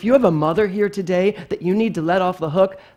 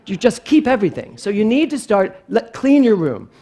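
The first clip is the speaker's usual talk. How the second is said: louder, higher mock-scolding mom; scolding mother; 5 semitones higher, 7 dB louder than usual. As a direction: lightly play a mom laying down the law. Mother scolds child in story.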